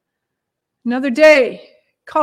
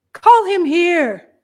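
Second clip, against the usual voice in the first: raised, slow call from afar; distant voice calling; 4 semitones higher, 4 dB louder, slower than usual. A narrator's reading. Jesus summons the beggar from a distance, calling out. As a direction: call it slowly across a distance. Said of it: "from a distance, he heard a voice".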